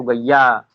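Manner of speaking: "गैया" as an altered gaay, with doubled y; rapid-speech variant. Shown as a demonstration. Teacher showing fast-speech change, gaay becomes "गैया". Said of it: "शीघ्र भाषण भी क्या हो जाता है".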